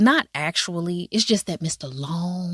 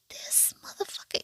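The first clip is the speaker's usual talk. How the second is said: whispered aside; 16 dB quieter than usual. Retold or imitated. Inner reaction to rude character.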